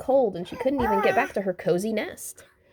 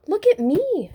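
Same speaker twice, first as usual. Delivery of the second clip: high, loud joyful call; happy hoot; 11 semitones higher, 6 dB louder than usual. A narrator's reading. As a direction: shout it to friends in flight. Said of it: "she hooted happily".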